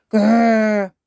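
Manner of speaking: loud, high play growl; toddler dinosaur growl. A narrator's reading. George playing the scary dinosaur, growling.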